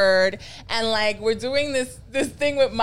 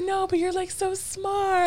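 imitating brother; 11 semitones higher, slower than usual. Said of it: "he was like".

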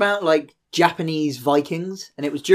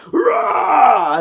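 high, loud mock war cry; a crowd's battle roar, played for laughs; hammed-up crowd roar; 10 semitones higher, 4 dB louder than usual. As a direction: roar like a cheering Viking horde. Crowd of Vikings roaring.

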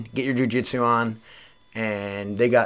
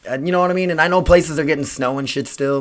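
ordinary talk

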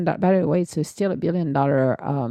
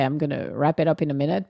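ordinary talk, nothing marked

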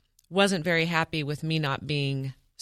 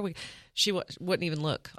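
usual speaking voice

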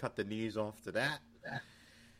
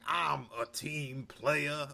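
slow, drawn-out imitation, pitched higher; put-on voice; 4 semitones higher, much slower than usual. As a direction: stretch each word out in a mock put-on voice. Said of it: "I don't think this is his voice".

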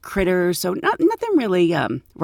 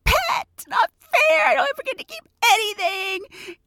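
put-on much higher, sobbing child's wail; crying kid. Boy begs the flight attendant to keep his snake, high crying wail. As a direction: whimper and wail like a boy losing his pet. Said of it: "The kid's like".

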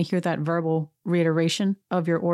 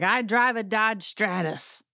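mock yell, pitched up and drawn out; put-on yeller at moderate volume; Will Ferrell; 4 semitones higher, slower than usual. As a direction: insist on it like a self-important sketch character. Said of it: "he just keeps yelling it".

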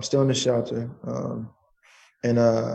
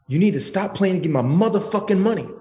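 higher-pitched retold tough-guy threat; gangster demand; 7 semitones higher than usual. Ending a voicemail to the man who owes him, mock gangster demand. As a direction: act out a tough demand for money. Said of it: "some gangster shit".

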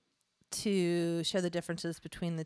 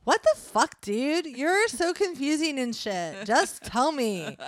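put-on exasperated Valley Girl, high and loud; heavy Valley Girl accent; 7 semitones higher, 4 dB louder, slower than usual. Confused roommate demanding an answer from the devil, heavy Valley Girl accent. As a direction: lean hard into Valley Girl, slowing down. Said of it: "I'm just leaning into the accent now".

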